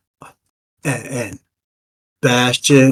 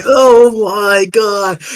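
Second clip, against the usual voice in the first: high, loud, gushing fanboy; starstruck fan; 10 semitones higher, 6 dB louder, much slower than usual. Acted. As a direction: gush over your hero, voice shooting up. Fan NPC awestruck.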